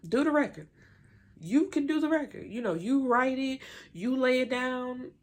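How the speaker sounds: high, sarcastic mock of a fed-up twin; Fallon, fed up. Fallon snapping at the group over the record, high mock sarcasm. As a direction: toss the whole record back at them, mocking. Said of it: "she's like".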